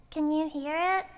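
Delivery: usual speaking voice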